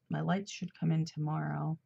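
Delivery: ordinary talk, nothing marked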